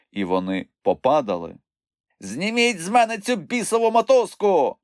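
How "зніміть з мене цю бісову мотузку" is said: high, raised-voice demand; angry shout. A narrator's reading. Moomintroll tangled in the rope, angry shout. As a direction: yell crossly to be set free. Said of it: "сердито закричав Мумітроль".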